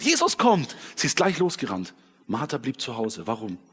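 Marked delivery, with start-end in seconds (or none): 0.0-0.8 excited call. Acted. Martha runs out calling.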